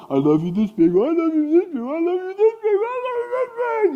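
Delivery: put-on one-up the kids, pitch climbing every time; playful escalating boast. Kids' love competition, playful escalation.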